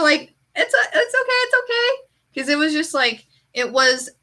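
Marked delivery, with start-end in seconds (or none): 0.5-2.0 high, wavering, placating trainee voice; mock nervous nurse. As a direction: soothe her while clearly panicking.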